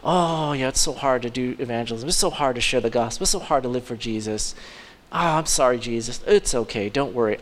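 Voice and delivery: low, soft self-pitying whine; mildly hammed-up self-pity and soothing; mock whining. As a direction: sigh out the complaint, then get coddled. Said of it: "we think of it in that way".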